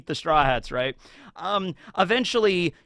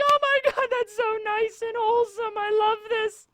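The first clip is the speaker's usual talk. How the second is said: very high, squealing delight; gushing fan squeal. Retold or imitated. Fan gushing over wholesome fan art, high squeal. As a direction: squeal over something adorable. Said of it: "and I'm like".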